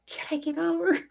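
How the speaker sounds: high, squeezed mock scream, held back in volume; screaming mom. Son recalling mom's lane-change cry, mock screaming. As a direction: imitate mom's screech without the loudness. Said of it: "we're screaming over".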